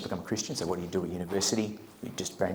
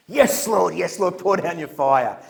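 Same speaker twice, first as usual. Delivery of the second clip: higher, louder eager agreement; eager amen; 8 semitones higher, 9 dB louder, slower than usual. Retold or imitated. The group affirming Albie's prayer, eager higher amens. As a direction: cheer him on like a keen congregation.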